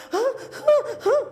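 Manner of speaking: short loud mock sob gasps; imitated sobbing gasps. Retelling her weeping secretary, short mock sobbing gasps. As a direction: gasp out the secretary's sobs. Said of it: "dia tiba-tiba nangis".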